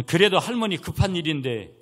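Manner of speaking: put-on high, loud plea from a child; imitated urgent protest. Granddaughter interrupting scolding grandmother, imitated urgent protest. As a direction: insist that it cannot wait. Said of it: "선녀가 막 그랬어요".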